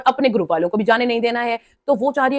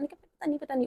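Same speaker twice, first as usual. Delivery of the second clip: mock coy dodge; 4 semitones higher, 13 dB quieter than usual. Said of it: "उधर ड्रामे कर रही हो बैठ के ना".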